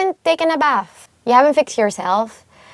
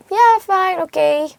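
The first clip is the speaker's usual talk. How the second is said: higher-pitched easy yes to a friend; casual agreement; 8 semitones higher than usual. Acted. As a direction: give in with a high, casual okay. Acted agreeing to a friend's invitation.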